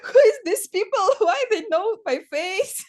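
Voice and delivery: high, loud, incredulous laughing questions; laughing bewilderment. Retold or imitated. Artist reenacts shock at being recognized, laughing in bewilderment. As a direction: act baffled while cracking up.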